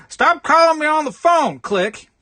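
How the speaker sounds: high, loud mock snap at a telemarketer; hammed-up angry hang-up; irritated man yelling down the phone; annoyed caller. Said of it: "some guy goes like".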